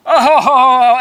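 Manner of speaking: loud, high mock ho-ho laugh; booming fake belly laugh; mocking laughter. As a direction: ham up the crowd laughing at him.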